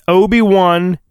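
ordinary talk

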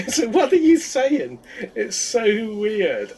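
high, drawn-out incredulity; amused bafflement. Real, not acted. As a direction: stretch it out high, in laughing disbelief. Baffled reaction to the mimicked line.

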